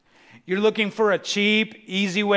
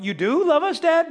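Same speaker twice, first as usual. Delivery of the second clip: higher, lightly voiced questioning kid; a hint of a child unsure of Dad's love; doubting child; 8 semitones higher than usual. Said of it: "your children look at you and going".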